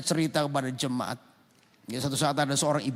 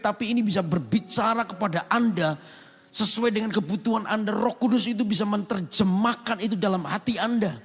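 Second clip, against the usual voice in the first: raised, forceful pulpit voice; preaching; 10 semitones higher, 6 dB louder than usual. Acted. Pastor preaching hard to his congregation, forceful. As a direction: drive the point home to the church.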